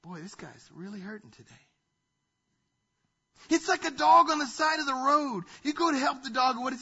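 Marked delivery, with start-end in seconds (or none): 0.0-1.7 soft, much quieter compassionate voice; God feeling for a hurting man; tender sympathy. Retold or imitated. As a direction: say it with pity, gently. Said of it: "you know what he says?".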